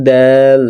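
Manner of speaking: "দাল" with the aa stretched three beats; "দাল" drawn out; long held vowel. Shown as a demonstration. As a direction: hold the aa of "দাল" long and level. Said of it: "তিন আলিফ লম্বা যেটা পড়তে হয়".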